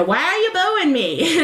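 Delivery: imitating Sylvia with a thick New York accent. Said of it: "and Sylvia says".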